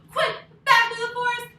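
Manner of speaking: high, loud hurried call; urgent shout. Acted. Story teacher to kids fleeing the bear, urgent. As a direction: urge everyone to flee fast.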